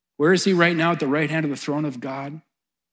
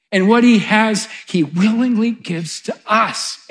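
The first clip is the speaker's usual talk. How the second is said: higher, louder, emphatic proclamation; climactic preaching; 7 semitones higher, 7 dB louder, slower than usual. Real, not acted. Preacher telling the church what Jesus gives, climactic proclamation. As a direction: ring it out, slowing on the final phrase.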